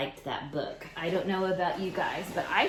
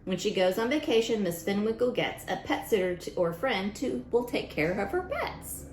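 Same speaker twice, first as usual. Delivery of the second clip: lively storybook read-aloud voice; reading to children; 3 semitones higher than usual. Acted. Teacher reading a rhyming book to kids, storybook voice. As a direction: read it out to the class with bounce.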